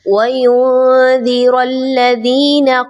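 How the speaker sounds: slow melodic tilawat with bouncing qalqala; Quranic recitation. Acted. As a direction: recite slowly and melodiously like a qari. Recites verse four. (धीमी सुरीली तिलावत)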